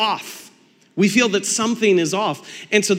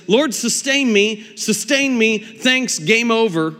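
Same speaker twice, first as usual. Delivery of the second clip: higher, louder breezy prayer, slowed at the start; a tossed-off prayer that hangs up early; mock quick prayer; 5 semitones higher, 3 dB louder, slower than usual. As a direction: rattle off a lazy prayer and call it done.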